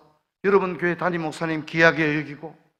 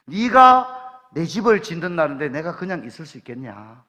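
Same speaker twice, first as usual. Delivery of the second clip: normal talking voice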